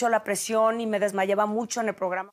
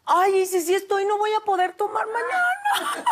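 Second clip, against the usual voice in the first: laughing dismay; 11 semitones higher than usual. Retold or imitated. Retold reaction to pregnancy test.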